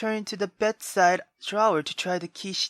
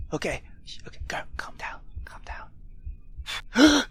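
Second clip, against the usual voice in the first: hushed whisper, talking herself down; whispering to self; 3 semitones higher, 4 dB quieter than usual. Real, not acted. Gamer whispering to herself to stay calm, hushed. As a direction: whisper it to steady your nerves. Calming herself in tense scene.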